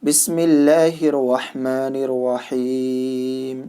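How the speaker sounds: drawn-out, chanted Quranic invocation; slow reverent recitation. Acted. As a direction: intone each word slowly like Quran recitation. Lesson begins with recited invocation.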